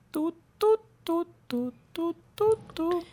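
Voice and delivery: high thin beeps like a phone line; mock beeping tone. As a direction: beep like a waiting phone. Imitates an on-hold tone. (pitidos agudos imitando un tono)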